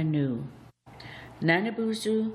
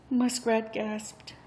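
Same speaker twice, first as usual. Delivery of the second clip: ordinary delivery, nothing marked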